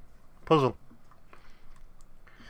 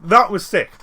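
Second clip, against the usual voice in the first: excited shout; 7 semitones higher, 12 dB louder, slower than usual. Real, not acted. Hyped reaction to solving puzzle.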